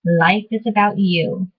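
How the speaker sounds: usual speaking voice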